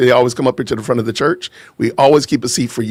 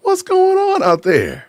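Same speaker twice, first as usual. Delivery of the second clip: slow, high-pitched call-out; hometown greeting; slower than usual. Real, not acted. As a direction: sing out the hello, high and stretched. Calling out to hometown viewers.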